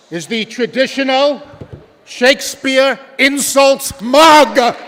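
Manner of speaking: loud, projected build to a big reveal; grand announcer. Acted. Host unveils the coveted contest prize to students, grand announcer. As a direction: proclaim it to the hall, stretching the last words high and loud.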